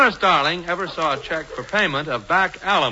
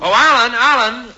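calling out; 5 semitones higher, 6 dB louder than usual. Acted. Hubert calls out loudly.

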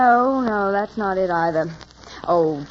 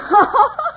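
high, louder burst of laughter; delighted laugh; 5 dB louder than usual. Acted. Client hearing the hairdresser's true identity, delighted laugh.

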